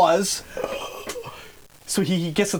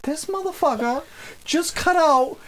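retold outraged thought; 9 semitones higher than usual. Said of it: "in that moment, I'm like".

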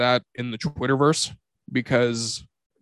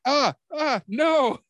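high-pitched squeamish protest; mock kid whine; little brother squealing; 14 semitones higher, much slower than usual. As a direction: squeal it like a kid dodging a fart. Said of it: "my little brother was like".

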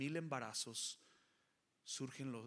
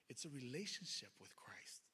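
slow near-whisper; hushed; 4 dB quieter than usual. Retold or imitated. Pastor hushing the key line to the church, slow whisper. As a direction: breathe the line out softly. Pastor quoting his own words.

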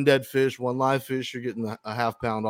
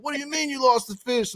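incredulous; 11 semitones higher than usual. Retold or imitated.

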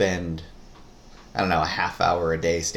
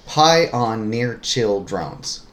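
word by word, spaced out evenly; deliberate clue reading; 3 semitones higher, 5 dB louder, much slower than usual. Shown as a demonstration. Reading a puzzle clue the partner must decode, slowly word by word.

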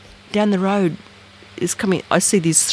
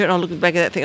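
usual speaking voice

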